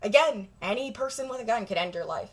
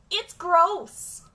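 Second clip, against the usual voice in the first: higher, punchy "It's"; emphatic disgust; 7 semitones higher, 3 dB louder than usual. Real, not acted. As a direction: hit "It's" hard in disgust. Repeats phrase, two ways.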